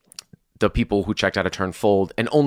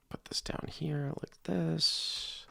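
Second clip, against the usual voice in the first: soft whisper under the breath, slower; 7 semitones higher, 14 dB quieter, slower than usual. Real, not acted. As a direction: whisper it to yourself while setting the card down. Quietly narrating moving a card.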